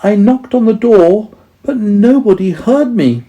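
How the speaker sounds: high, sheepish voice; apologetic mole. A narrator's reading. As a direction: stammer out a sorry as a shy mole. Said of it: "said the mole".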